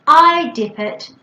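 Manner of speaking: "I" high, loud and drawn out; child's answer. Shown as a demonstration. Child answering the parent's question, stress on "I". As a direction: lean hard on "I", slowly. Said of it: "And they can say".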